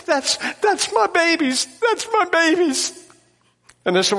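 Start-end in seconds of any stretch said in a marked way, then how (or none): 0.1-2.9 put-on high, breathless mother recognizing her boys; startled mother. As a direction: voice the mother, pitched up in disbelief.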